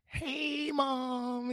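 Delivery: high, soft, drawn-out greeting; light singsong imitation of a girlfriend; girlfriend greeting mom. Girlfriend walking in to greet her mom, high and soft.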